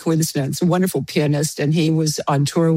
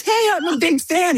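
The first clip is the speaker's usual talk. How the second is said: much higher, excited retold voice; eager fan; 12 semitones higher than usual. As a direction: mimic a thrilled fan up high. Retold fan eagerly introduces himself.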